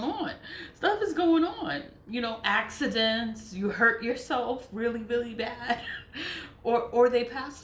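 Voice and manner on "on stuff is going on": laughing. Real, not acted. Laughs while listing misfortunes.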